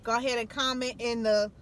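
loud, upbeat sign-off; vlog outro. Acted. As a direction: hype up the goodbye to viewers.

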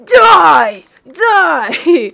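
loud pretend threat; mock battle cry. Gamer pretending to attack another player, mock battle cry. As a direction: shout it like a fake villain. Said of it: "I'm just kidding".

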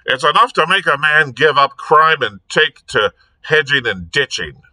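harassed. A narrator's reading. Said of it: "harassed Sugg".

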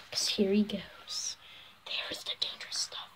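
soft unvoiced whisper. Real, not acted. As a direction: whisper it like a secret. Whispering before the sour bite.

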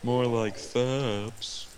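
very slow, stretched-out punchline; slow drawn pun. Pretend. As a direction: drag out the joke word by word.

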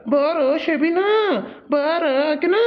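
lightly voiced coaxing call, imitated; a hint of a sweet-talking wife; mock sweet calling. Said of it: "ಅಂತ ಹೇಳಿ".

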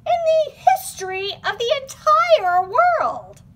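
high and loud, gushing; excited. Acted. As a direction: gush with big excitement. Over the top praise.

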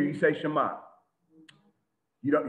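usual speaking voice

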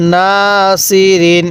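না held long, then level সি রিন; recitation model. Shown as a demonstration. Teacher showing how to read, drawn-out না সি রিন. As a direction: stretch না and chant সি রিন evenly. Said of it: "কিভাবে পড়ব".

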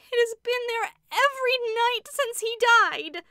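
high, loud desperate cry; frightened cry. A narrator's reading. Rebecca tells her sisters about the nightly shadow, loud frightened cry. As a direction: cry it out in dread. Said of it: "cried Rebecca".

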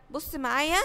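ordinary talk

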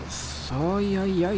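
normal talking voice

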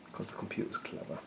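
whispered aside. Real, not acted.

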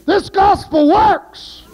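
shouted, rising preacher call; preaching at full force. Real, not acted. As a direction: rouse the congregation with a high shout. Preacher rouses the church.

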